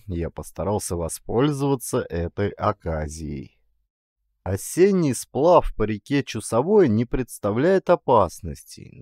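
ordinary delivery

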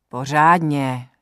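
slow, firm reminder to be thorough; admonishing. Acted. Old herbalist scolding Joza while he washes her, slow firm admonition. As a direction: tell him off mildly, drawing the word out. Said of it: "napomenula ho Lucka".

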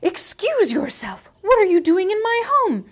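high, loud indignant fox shout; startled fox. Acted. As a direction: scold the intruder in your home.

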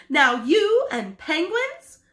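exasperated. Acted. Wife scolds husband over penguins, exasperated. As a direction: drag it out in disbelief.

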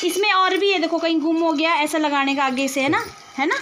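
loud and high so a deaf ear catches it. Real, not acted. To the hard-of-hearing uncle, loud and high. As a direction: call out clearly to someone hard of hearing. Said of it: "ज़ोर ज़ोर से चिल्ला चिल्ला के बोलना पड़ता है".

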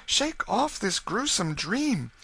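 high-pitched imploring mother; distraught pleading. A narrator's reading. Mother begging her child to unsay these words, distraught. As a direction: beg desperately in a high voice. Mother begs son to recover wits.

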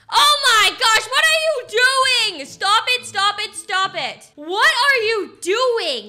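loud, high scolding, slow and drawn out; yelling at the kid. Acted. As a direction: stop her at full volume. Mom finds kid shooting house.

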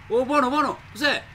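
high loud scared pleading; alarmed shouts. Real, not acted. Gamer yells in alarm at a strange enemy. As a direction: yell in fright at the attacker.

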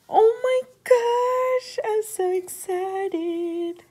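high, slow, loud squeal of joy; squealing excitement. Real, not acted. Opening sister's crochet gift, squealing with excitement. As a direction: let the delight spill out.

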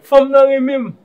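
high, very loud excited burst; excited boasting. Acted. Man tells his friend of a woman, excited and loud.

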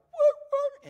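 very high, loud mock yelps; imitated dog yelp. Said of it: "and that dog went".

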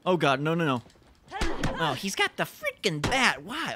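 loud panicked protest; real alarm in a game. Real, not acted. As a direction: cry out as the threat closes in. Enemy with bat approaching.

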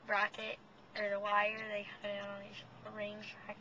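talking while pulling lip back; fingers in mouth. Real, not acted. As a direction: lip held open by fingers. Seen 0: Fingers hold lip open showing braces throughout; hands drop by 2.1.

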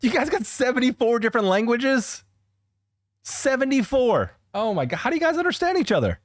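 high-pitched disbelief, stretching the repeated number; astonished. Real, not acted. Viewer stunned by seventy-four languages, high astonished voice. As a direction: react in amazement, voice jumping way up.

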